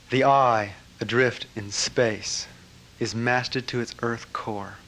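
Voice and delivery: slow, spacious poetry reading; poem reading. Acted. Reading the poem to the gathering, slow and spacious. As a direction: read each line and let it land. Reads the master's poem slowly.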